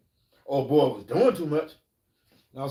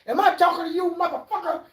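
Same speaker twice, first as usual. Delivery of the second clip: much higher, louder confrontation; angry challenge; 3 dB louder than usual. Retold or imitated. Wolf confronts Muddy at the funeral, loud high angry. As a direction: bark the challenge. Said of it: "he said".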